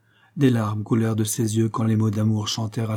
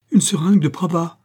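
high, loud exclamation; startled recognition; 7 semitones higher, 6 dB louder than usual. A narrator's reading. Anxious woman sees the syringe, startled recognition. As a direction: exclaim as you spot it, pitch jumping.

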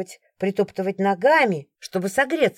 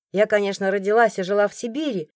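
ordinary delivery

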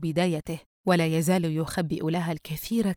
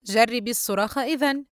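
higher, amused taunt; laughing mockery; 5 semitones higher than usual. A narrator's reading. Brother suggesting sister scream instead, amused mockery. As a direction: tease her with amused scorn. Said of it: "فريد ضاحكًا".

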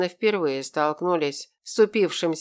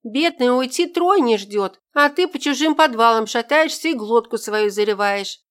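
scolding; 6 semitones higher, 5 dB louder than usual. Acted. Friend scolds drunk god.

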